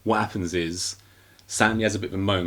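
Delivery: usual speaking voice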